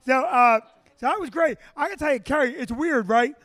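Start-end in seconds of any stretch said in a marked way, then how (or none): none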